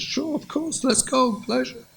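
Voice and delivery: high, soft willing agreement; eager husband. Acted. Husband gladly agreeing to take his wife out, high and willing.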